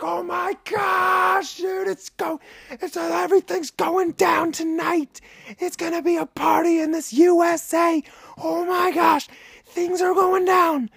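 very high, loud, squeaky party voice; silly put-on voice. Hyping up the battle in a very high, squeaky, silly voice. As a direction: shriek it like a hyped cartoon character. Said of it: "I'm talking in that voice".

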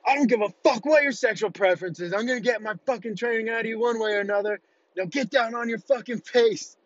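high, fast barking drill sergeant; drill instructor yell. Retold or imitated. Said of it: "He's like".

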